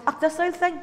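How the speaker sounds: high, dismissive little sigh of modesty; mock brush-off. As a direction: play the shy deflection lightly. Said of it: "you go".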